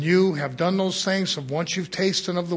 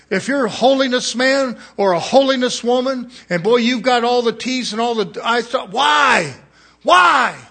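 higher and louder, shouting the why; full-force preaching; 8 semitones higher, 7 dB louder than usual. Acted. Preacher calls holiness folk to spend themselves, shouting. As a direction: thunder it at the congregation, holiness preacher style.

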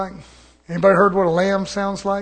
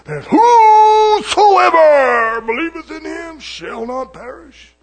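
mimicking Satan; 4 semitones higher, 5 dB louder, slower than usual. Satan quoting scripture like God, drawn-out mock-holy delivery. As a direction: drag it out into a showy preacher's cry, then sink back. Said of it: "that's the way he talks".

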